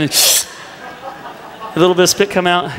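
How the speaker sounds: drawn out through laughter; slowly drawling the words as a laugh breaks through. Retold or imitated. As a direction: stretch it slowly while laughing. Retelling brother's spit tease.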